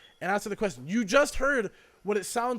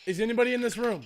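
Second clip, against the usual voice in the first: calling out to spirits; 4 semitones higher, faster than usual. Acted. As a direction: call out into an empty room. Asking the spirit box questions.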